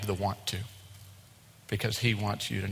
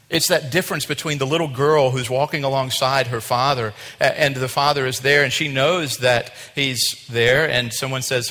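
loud, emphatic preaching; projected sermon delivery; 3 semitones higher, 9 dB louder than usual. Real, not acted. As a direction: build the illustration with forceful stresses. Preaches with force.

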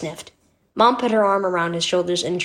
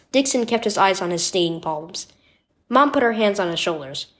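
ordinary delivery

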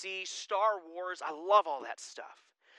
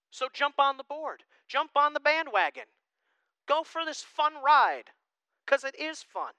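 high, lifted, building exhortation; rally them aboard, pitched up high; rousing preaching; 9 semitones higher, 5 dB louder than usual. Real, not acted. Pastor urging church to join in, rousing preaching.

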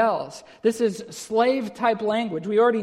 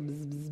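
soft, low drawn-out bzzz; low buzzing noise on a long z; imitated buzzing; 4 semitones lower, 11 dB quieter than usual. Shown as a demonstration. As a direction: buzz quietly like the sound itself. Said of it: "you speak it like it sounds".

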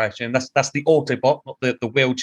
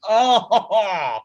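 high, rising cry of dismay; 7 semitones higher than usual. Retold or imitated. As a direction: groan it out in disbelief. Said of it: "And I'm like".

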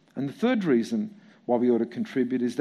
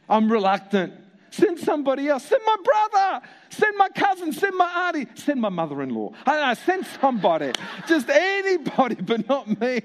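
high, whiny begging to send anyone else; pleading excuse; 9 semitones higher than usual. Retold or imitated. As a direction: pass the job off, a little desperate. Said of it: "the worst excuse ever".

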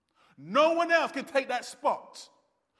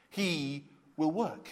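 slow emphatic repeat; 6 semitones lower, 6 dB quieter, slower than usual. Real, not acted. Slowed repeat of key phrase.

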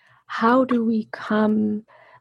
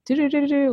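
mock alarm noise; 8 semitones higher, much faster than usual. Imitating brain's alarm state.

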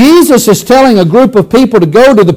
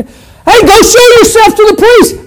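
much higher, happy call across the road; yelling back; 10 semitones higher than usual. Shown as a demonstration. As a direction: holler the order to the lepers. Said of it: "Jesus just yelled back to him".

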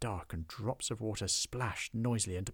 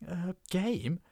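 high, timid question; nervous squeak; 9 semitones higher, 3 dB louder than usual. Acted. Goblin nervously asking the dwarf, a high squeak. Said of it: "squeaked Hobgob".

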